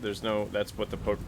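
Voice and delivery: usual speaking voice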